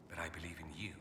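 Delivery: soft, low, whispered sincerity; sincere hush. Acted. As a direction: tell her gently and earnestly. Sincere confession to Inquisitor.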